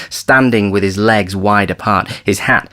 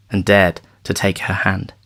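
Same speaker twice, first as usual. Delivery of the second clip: usual speaking voice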